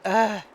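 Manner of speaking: loud annoyed ugh; disgusted groan. Real, not acted. Annoyed at the turnpike.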